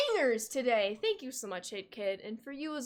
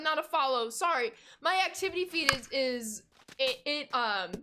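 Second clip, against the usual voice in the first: ordinary delivery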